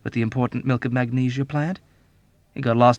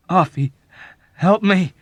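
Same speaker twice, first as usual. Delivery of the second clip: louder pleading plea; pleading, almost weeping; 9 semitones higher, 7 dB louder than usual. Acted. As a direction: beg through near tears. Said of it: "he pleaded, almost weeping".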